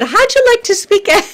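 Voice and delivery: high, loud playful squeal; playful invitation. Retold or imitated. Pastor retelling inviting Evan, in a high playful squeal. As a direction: pop the question like a cheeky surprise. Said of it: "and I said".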